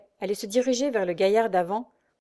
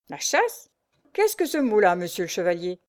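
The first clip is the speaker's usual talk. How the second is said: indignant exclamation. A narrator's reading. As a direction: bristle at the word. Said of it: "s'exclama le capitaine de frégate". (s'exclamer, indigné, très aigu)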